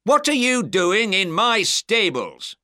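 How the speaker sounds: high, loud, outraged demand; furious innkeeper catching snoopers; angry cry. A narrator's reading. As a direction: confront the intruders angrily. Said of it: "His face was red and angry".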